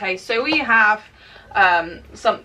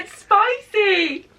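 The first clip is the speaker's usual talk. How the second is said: high pained squeal; 10 semitones higher, much slower than usual. Real, not acted. Reacting to spicy snack.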